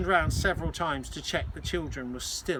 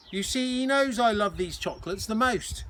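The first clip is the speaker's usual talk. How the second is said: put-on Cockney boy; 6 semitones higher, 3 dB louder than usual. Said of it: "George's Cockney accent".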